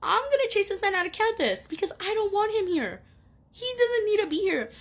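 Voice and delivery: determined to run him off campus; fired-up resolve. Retold or imitated. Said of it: "And I was like".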